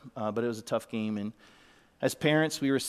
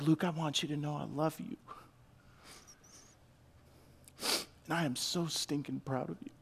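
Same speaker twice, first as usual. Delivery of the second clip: tender fatherly words; 3 semitones higher than usual. Retold or imitated. Father speaking tenderly to son.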